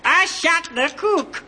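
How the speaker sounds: high squeaky dummy voice; squeaky ventriloquist dummy. Acted. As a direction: pipe it up like a little puppet. Dummy's punchline in demo.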